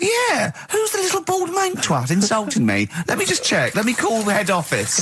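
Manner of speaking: high, far-off put-on voice of a confused builder; mock builder voice, pitched well up. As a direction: squeak it out like a dim workman. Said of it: "He didn't come there and go".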